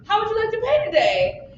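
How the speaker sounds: a hint of a chirpy clerk asking the customer; store clerk. Improviser voices a checkout clerk.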